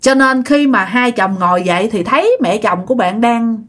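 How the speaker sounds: usual speaking voice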